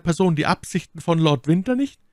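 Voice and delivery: high, probing lady's voice; Milady questioning. Acted. Milady questions her jailer about Lord Winter's intentions, probing.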